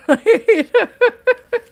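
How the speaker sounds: short high giggle; high laugh. Real, not acted. Laughing after her stage story.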